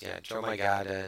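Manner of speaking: normal talking voice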